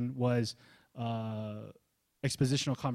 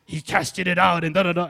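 ordinary delivery

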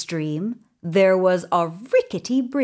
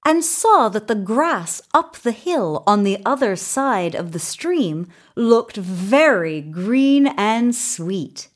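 ordinary delivery, nothing marked

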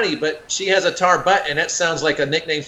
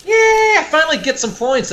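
excited; 10 semitones higher, 5 dB louder than usual. Real, not acted. Celebrating finally scoring points.

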